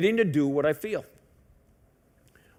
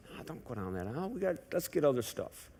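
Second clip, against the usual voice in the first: gentle warning; 3 semitones lower, 13 dB quieter than usual. Retold or imitated. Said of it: "Cindy said".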